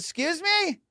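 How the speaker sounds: high rising question; confused lady. Retold or imitated. Lady with no idea what he means, high puzzled question. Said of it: "This lady has no idea".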